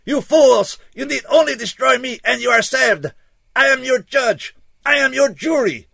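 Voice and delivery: high, desperate alien shouting; crying out. A narrator's reading. Alien begging the humans to destroy him, crying out. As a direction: declaim it at full volume, pitched high. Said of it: "he cried".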